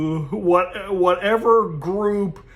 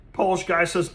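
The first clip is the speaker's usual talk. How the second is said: ordinary delivery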